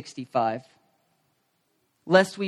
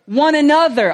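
louder, punchy call for the room to repeat; leading the congregation; 3 semitones higher, 6 dB louder than usual. Shown as a demonstration. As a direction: say it out so they echo you. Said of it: "Everybody say this word with me".

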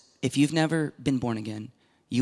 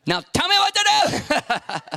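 much higher, slow, whining; mock whiny plea; 4 dB louder, slower than usual. Preacher mocking his frustrated prayer, high whine.

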